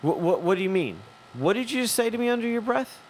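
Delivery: high-pitched retold demand, rising at the end; incredulous confrontation. Confronting a man who muttered under his breath, incredulous. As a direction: challenge him in disbelief, pitch climbing.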